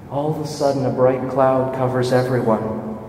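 normal talking voice

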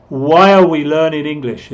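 "are" shrunk to uh after a loud "Why"; emphatic demo of weak "are"; reduced "are". Shown as a demonstration. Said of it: "Voy a repetir de nuevo".

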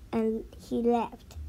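ordinary delivery, nothing marked

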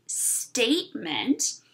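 Statement spoken very slowly; slow, clear syllables. Shown as a demonstration. Teacher modelling a word: very slow. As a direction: draw it out so kids repeat. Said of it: "can you say that with me".

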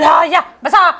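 loud, high imitation of greeting guests; mock shouted welcome. Sister mimicking how the girl yells at guests, mock shouting. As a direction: call it out too loudly, imitating her. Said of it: "असं एवढं मोठ्या मोठ्याने ओरडू नको ना".

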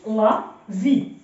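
slow LA, pause, then VI: "لا في"; syllable by syllable. Shown as a demonstration. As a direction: sound out "لا في" in two separate syllables. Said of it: "ولكن الحرف اللي قبل أو كيتنطق". (قراءة "لا في" مقطع بمقطع ببطء)

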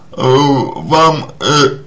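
ordinary talk

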